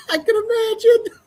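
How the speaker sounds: laughing hard. Real, not acted. Laughing at hated quarterback.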